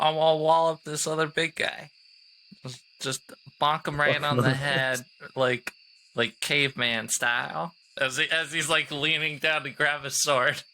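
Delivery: normal talking voice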